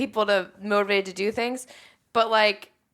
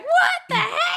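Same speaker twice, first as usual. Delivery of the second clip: very high, loud, drawn-out squeal; mock shock; 5 dB louder, slower than usual. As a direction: squeal it in hammed-up disbelief, half laughing. Imitating shock at the stabbed bag.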